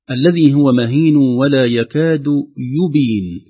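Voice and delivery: slow chanted verse; slow recitation. Shown as a demonstration. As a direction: recite carefully to show يبين. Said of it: "كلمة يبين". (تلاوة بطيئة واضحة)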